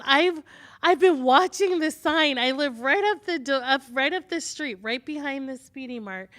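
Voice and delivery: high, loud, breathless excitement; eager rush. Retold or imitated. Pregnant client telling the midwife she's been watching the sign. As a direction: gush like you've waited weeks for this.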